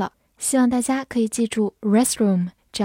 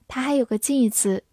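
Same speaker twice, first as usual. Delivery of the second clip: ordinary delivery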